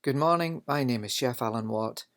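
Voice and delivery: ordinary talk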